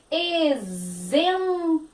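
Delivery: Exemplo drawn out, ex as ez; very slow Exemplo. Shown as a demonstration. Teacher sounding out Exemplo with z. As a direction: stretch Exemplo with a z. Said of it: "o X aqui vai ter som de Z".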